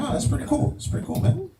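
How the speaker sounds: high airy voice of an impressed stranger. Retold or imitated. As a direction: play him as a wide-eyed dude.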